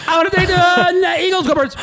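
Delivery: high, laughing Philly fan imitation; hammed-up Philly fan. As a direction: play a Philly local through laughter. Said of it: "like you guys do down there".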